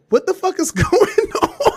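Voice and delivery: high, drawn-out mock panic with a laugh. Calling boyfriend while freaking out, mock high panic. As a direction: squeal it slowly, laughing through the panic. Retold panic attack on the phone.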